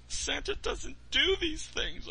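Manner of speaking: put-on very high, slow wailing child voice; crying out. Boy protesting to Santa, very high slow wail. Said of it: "I cried".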